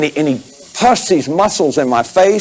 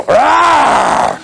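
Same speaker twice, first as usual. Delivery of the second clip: mock growl, pitched high; 9 semitones higher than usual. Shown as a demonstration. Therapist shows the mirror anger exercise, playful growl. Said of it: "and growl".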